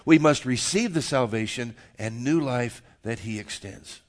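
loud, deliberate declarations with weighty pauses; emphatic preaching. Real, not acted. Pastor declaring the gospel to the congregation, emphatic preaching. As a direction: preach it firmly, landing hard on key words.

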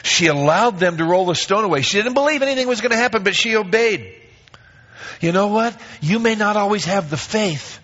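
high, loud, fast preaching push; rising fervent sermon climax; impassioned preaching. Acted. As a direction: drive the point home to the church. Preaching at full force.

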